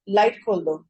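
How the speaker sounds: curt flat command with no softening; just bark the order at her; blunt order. Shown as a demonstration. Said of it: "ایک ہے رویہ". (روکھا حکم)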